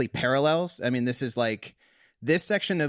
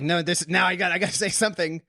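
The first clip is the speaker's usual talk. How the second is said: can't hold it in; 5 semitones higher, faster than usual. Acted. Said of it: "he just can't hold it in anymore".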